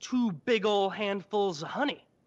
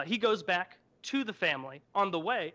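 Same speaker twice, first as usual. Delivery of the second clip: ordinary delivery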